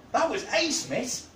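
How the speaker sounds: put-on high-pitched eager boy's voice; grinning excitement. A narrator's reading. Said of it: "a broad grin on his face".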